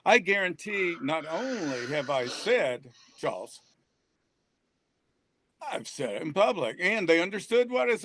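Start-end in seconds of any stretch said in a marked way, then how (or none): 3.2-3.6 "Charles" said as Jaws, low and soft; one quiet syllable, "Charles" pronounced Jaws; demonstrated pronunciation. Shown as a demonstration. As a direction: say "Charles" like the movie title Jaws, dropped low. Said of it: "the correct pronunciation is".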